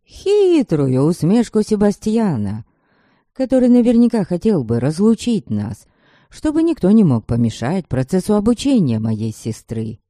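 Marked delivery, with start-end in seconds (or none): none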